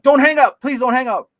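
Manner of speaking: high, loud retold plea; desperate pleading. Begging a friend's wife to stay on the phone, desperate.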